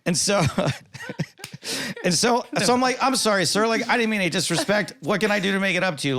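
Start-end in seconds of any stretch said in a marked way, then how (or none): 3.0-6.2 retold high-pitched, conciliatory plea; placating apology. Placating the angry guy after a misunderstanding, apologetic. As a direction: apologize politely without cowering, acting it out. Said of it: "but I'm not, like, cowering".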